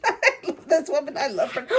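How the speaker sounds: gushing with a laugh breaking in; laughing affection. Real, not acted. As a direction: say it through a delighted laugh. Gushing over the designer, laughing.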